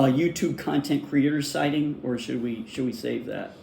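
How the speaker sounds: ordinary talk, nothing marked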